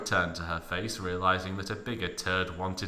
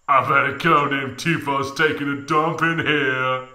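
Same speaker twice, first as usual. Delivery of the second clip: Cloud's voice; 5 semitones higher, 7 dB louder than usual. Acted. Cloud voiced in read story.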